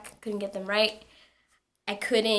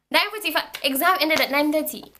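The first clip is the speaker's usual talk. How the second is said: loud, higher-pitched alarm; alarmed outburst; 5 semitones higher, 5 dB louder, faster than usual. Retold or imitated. Student realising she missed her exam, alarmed outburst. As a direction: blurt it out in rising panic. Panics at missing the exam time.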